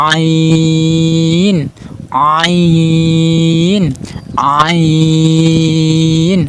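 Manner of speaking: আইন drawn out from mid-throat, loud and long; slow loud demo of the Arabic letter আইন from the throat; throaty ain demo. Shown as a demonstration. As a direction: press from the middle of the throat and hold আইন. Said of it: "হাত দিয়ে চাপ দিয়ে আইন হরফ উচ্চারণ করবেন".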